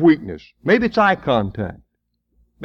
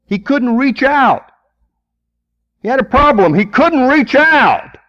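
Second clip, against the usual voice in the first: preaching emphasis; 10 semitones higher, 5 dB louder than usual. Real, not acted. Preacher stressing the withered hand.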